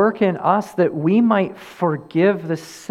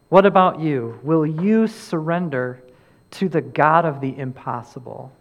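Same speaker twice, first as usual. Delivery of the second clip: usual speaking voice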